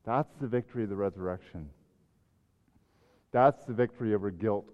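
ordinary talk, nothing marked

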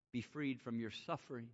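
ordinary delivery

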